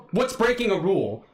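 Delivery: ordinary delivery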